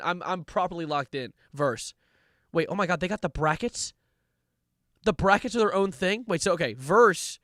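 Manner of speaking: normal talking voice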